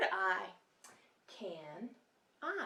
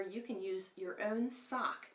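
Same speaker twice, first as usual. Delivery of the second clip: usual speaking voice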